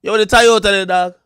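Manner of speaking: loud, high Jamaican patois call; Jamaican accent. Acted. Showing off Jamaican talk, loud Jamaican accent. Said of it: "That's Jamaican shit".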